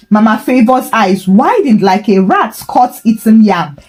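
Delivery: usual speaking voice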